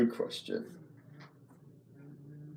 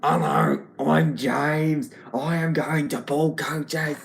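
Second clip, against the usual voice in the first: loud, higher-pitched character voice, slow at the end; James; 5 semitones higher, 4 dB louder, slower than usual. Shown as a demonstration. Showing viewers the new James voice, loud and put-on. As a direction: belt it out like a cartoon scarecrow. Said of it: "I think it'll sound more like Spud from Bob the Builder".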